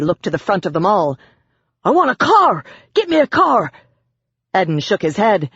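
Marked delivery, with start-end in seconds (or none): 1.8-3.7 high, loud, panicked demand; shouted demand. A narrator's reading. Cornered man shouting demands at the police. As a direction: demand it at full voice. Hostage-taker demanding escape.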